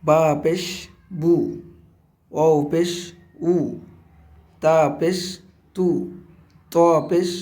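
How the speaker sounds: letter name, পেশ, then a short u syllable like বু; spelling drill with pesh. Shown as a demonstration. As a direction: recite each letter with পেশ and its u sound, pausing between. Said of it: "এইভাবেই আমাদের প্রত্যেকটা অক্ষর সুন্দরভাবে উচ্চারণ করতে হবে".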